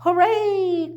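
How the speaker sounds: high, loud happy cheer; a joyful shout of hooray. A narrator's reading. Chicks cheering that Baby Owl will read. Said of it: "cried all the chicks".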